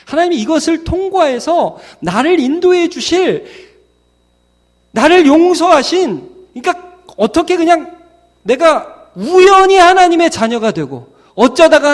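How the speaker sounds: high-pitched, urgent exposition; impassioned preaching. Real, not acted. Preacher explaining who God is, impassioned. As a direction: press the point home from the pulpit.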